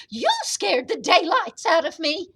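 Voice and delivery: put-on high, loud old lady voice; hammed-up high-pitched fright; startled old woman's cry. A narrator's reading. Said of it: "cried the tiny old woman".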